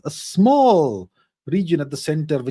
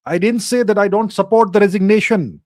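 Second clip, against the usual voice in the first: louder, pressing the correction home; firm raised voice, correcting the viewer; raised, insistent; 5 semitones higher, 6 dB louder than usual. Real, not acted. Streamer to commenter who misread him, raised voice. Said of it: "I'm not trying to shout at you".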